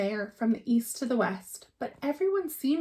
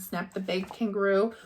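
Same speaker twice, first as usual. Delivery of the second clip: plain reading voice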